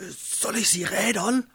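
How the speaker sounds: high, drawn eager question; servant eager to punish. A narrator's reading. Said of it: "der wieder nach Bestrafung war, fragte". (straflustige Dienerin, hohe Stimme)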